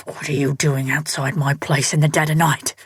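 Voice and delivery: low, gritted-teeth growl; an angry man pinning an intruder, low and irate. A narrator's reading. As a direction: grate it out through clenched teeth. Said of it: "The man's voice, irate and low, grated out between clenched teeth".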